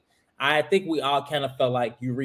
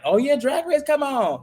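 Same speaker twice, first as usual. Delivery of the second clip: high-pitched eager cheer; excited reaction; 11 semitones higher than usual. Acted. Host mimics a fan thrilled that Drag Race is on, excited. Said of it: "versus being like".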